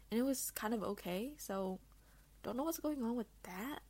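ordinary talk, nothing marked

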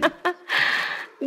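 high-pitched delighted laugh. Real, not acted. King laughs after urging her.

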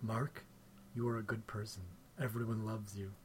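very soft, low murmur of reassurance; imitated bedtime self-affirmation. Co-host mocking Mark's bedtime tape, hushed and low. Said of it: "he makes a tape before he goes to bed".

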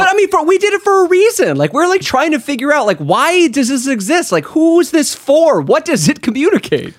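high, animated, insistent pitch; high-pitched excited voice. Real, not acted. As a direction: squeak it out defending the point.

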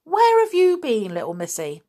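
high, emphatic wonder; amazed. A narrator's reading. Inspector marvels at the missing girl turning up, amazed. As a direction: exclaim with swooping astonishment.

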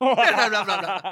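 high silly blah with laughter; giggly nonsense; laughing babble. Real, not acted.

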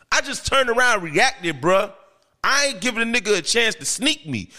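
ordinary delivery

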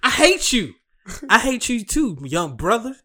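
hammed-up dubbed line; censored movie quote. Laughing through the censored movie quote to a friend.

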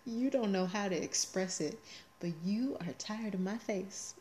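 ordinary talk, nothing marked

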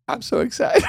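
giddy squeal breaking into laughter; mock-excited. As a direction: play up giddy excitement, voice shooting high. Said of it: "not the way I roll".

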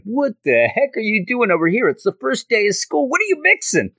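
high-pitched mock scolding teacher; Professor Connors. Professor confronting students after an explosion, high mock outrage. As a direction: imitate an aghast teacher, climbing in pitch. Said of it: "Professor Connors comes over and is like".

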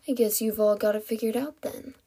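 plain reading voice

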